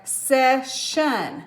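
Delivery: "session" slowly split as SESS, SHUN; sounded out syllables. Shown as a demonstration. Teacher sounds out a coded word for pupils, "session" slowly as sess-shun.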